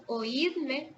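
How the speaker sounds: oídme said slowly, o-íd-me in three clear syllables; oídme pulled apart slowly, í stressed; slow syllable demo. Shown as a demonstration. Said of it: "la primera sílaba es o".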